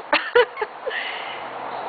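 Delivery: a bitter high laugh, breathy and strained; high forced laugh. Acted. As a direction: force out a laugh through the hurt.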